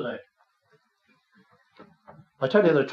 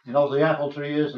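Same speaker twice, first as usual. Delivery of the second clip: ordinary talk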